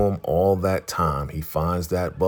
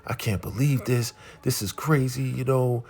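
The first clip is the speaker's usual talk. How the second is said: higher-pitched imitation of distress; mock tearful disbelief, pitched up; retold crying; 6 semitones higher, 3 dB quieter than usual. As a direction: mimic a distraught wife without real sobs. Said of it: "she's just crying like".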